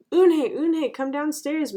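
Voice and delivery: high, loud motherly call; calling upstairs. A narrator's reading. Mother summoning Eunhye downstairs, calling out high and loud. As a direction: sing out the name to carry upstairs. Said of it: "Mother called up to Eunhye".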